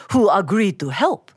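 usual speaking voice

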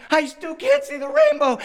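put-on very high, loud child whine; wailing toddler. Three-year-old missing the rainbow, high loud wail. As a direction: play a small girl near tears. Daughter wails, near tears.

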